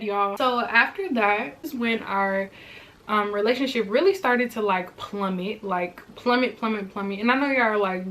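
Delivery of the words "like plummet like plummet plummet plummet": plummet, plummet said lower and softer each time; trailing repetition. Shown as a demonstration. Describing the relationship collapsing, fading voice. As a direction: let plummet sink away. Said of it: "repeated word sinking".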